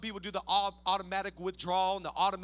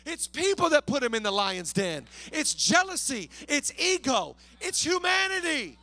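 raised, forceful sermon cry; emphatic preaching; 5 semitones higher, 4 dB louder than usual. Real, not acted. Preacher telling congregation who put Daniel in the den, emphatic preaching. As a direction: drive each point home, swelling louder.

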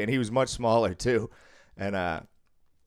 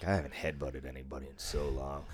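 muttered thought; 10 semitones lower, 10 dB quieter than usual. Retold or imitated. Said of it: "I was like".

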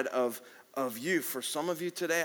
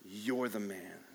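quiet accusation; 6 semitones lower, 6 dB quieter, slower than usual. Acted.

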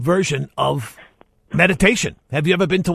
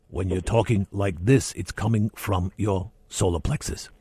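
voice from the solar plexus; 6 semitones lower, 5 dB quieter than usual. Shown as a demonstration. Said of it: "listen to my voice".

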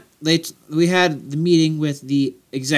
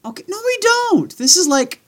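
high protest. Real, not acted. Protesting long episode.

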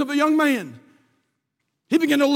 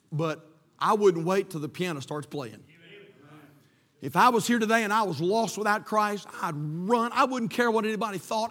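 ordinary talk

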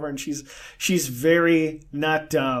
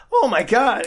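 shocked exclaim; 7 semitones higher, 5 dB louder than usual. Retold or imitated. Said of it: "this woman's like".

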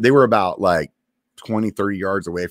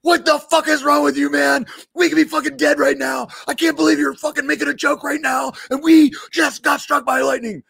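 furious outburst. Retold or imitated. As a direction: blow up at him in disbelief. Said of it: "he just loses his shit".

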